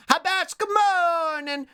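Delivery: high, slow sing-song hello; cutesy greeting. Real, not acted. Streamer greets a viewer in chat, high sing-song. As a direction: coo a drawn-out good morning to a friend.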